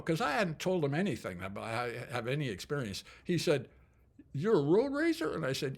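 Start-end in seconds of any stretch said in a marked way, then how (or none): 4.3-5.3 high, loud Southern coach, amazed; the Southern boy asks in disbelief; Southern accent, asking in surprise. Retold or imitated. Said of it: "when I imitate accents".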